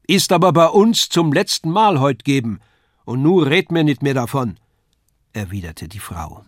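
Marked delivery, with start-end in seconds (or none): 0.1-4.6 loud, high, scolding dialect; final retort. A narrator's reading. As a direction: close the argument with your husband. Wife ends the quarrel.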